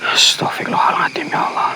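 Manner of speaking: real frightened whisper; scared whisper. Real, not acted. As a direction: whisper it so nothing hears you. Frightened ghost hunt at night.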